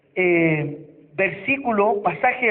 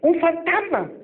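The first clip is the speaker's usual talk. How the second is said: higher alarmed cry; frightened shout; 6 semitones higher than usual. Acted. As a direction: yelp like a scared disciple. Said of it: "entre paréntesis".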